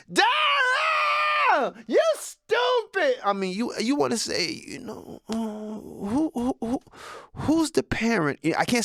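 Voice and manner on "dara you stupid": much higher, slow shriek; screaming kid. Retold or imitated. Kid shrieking at Daryl for no reason, very high. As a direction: shriek the name across the bus. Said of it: "he said it for no reason".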